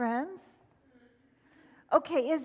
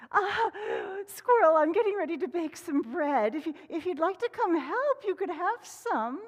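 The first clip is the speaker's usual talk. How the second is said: higher hen asking for help; 6 semitones higher than usual. Acted. Hen asking Squirrel to help bake, higher voice. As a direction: ask sweetly for help. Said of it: "She said".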